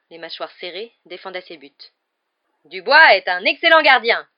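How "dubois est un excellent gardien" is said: raised, loud sports commentary; commentator. A narrator's reading. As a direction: praise the keeper for the stands to hear. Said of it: "commenta Lee Jordan". (commentaire sportif sonore)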